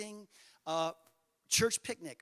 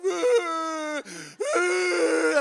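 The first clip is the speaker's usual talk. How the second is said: high, loud hammed-up kid bawling; mock child wailing; 9 semitones higher, 10 dB louder than usual. The boy hit by the softball, loud mock wail. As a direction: wail like a seven-year-old hit by a ball. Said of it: "I'm crying".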